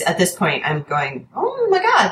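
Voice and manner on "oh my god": sudden high squeal of delight; excited gasp. Retold or imitated. Clerk thrilled by the owner's book choice, high gushing squeal. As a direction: gush like you just found treasure. Spotting great books in the bags.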